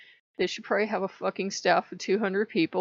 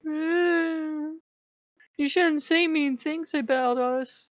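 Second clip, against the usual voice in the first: higher, slow whiny mock voice; mocking company rep; 7 semitones higher, much slower than usual. Imitating the shop's rep scolding her, whiny and higher. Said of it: "be like".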